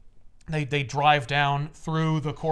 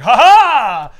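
big, loud "ha ha" of triumph; higher, booming villain laugh; triumphant mock laugh; 8 semitones higher, 15 dB louder than usual. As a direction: laugh like you have all your power back. Beyonder exults with regained omnipotence.

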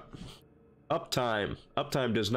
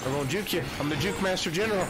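excited bragging; 7 semitones higher, faster than usual. Real, not acted. Bragging mid chase.

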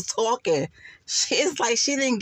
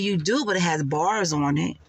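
ordinary talk

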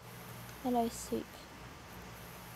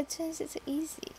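whispered; 3 semitones higher, 9 dB quieter than usual. Real, not acted. Correcting self, trailing off.

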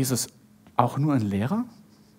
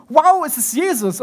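higher-pitched acted astonishment; amazed recognition; 7 semitones higher than usual. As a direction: voice lifts in sudden wonder.

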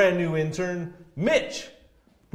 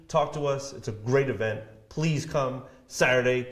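ordinary delivery

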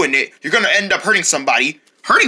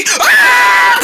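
very high, loud shriek; jump scare scream; 6 dB louder than usual. Pretend. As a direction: burst out with a piercing shriek.

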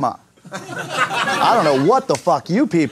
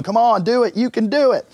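cheering on; 6 semitones higher than usual. Retold or imitated. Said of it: "chasing her around going".

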